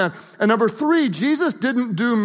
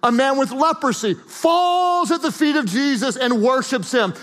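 normal talking voice

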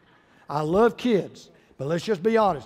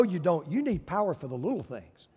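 normal talking voice

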